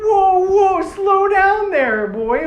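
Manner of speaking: high, loud, drawn-out Irish holler; hammed-up Irish accent, calling a halt. Toa stops them gutting the bear, loud Irish accent. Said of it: "they would just make them have Irish accents".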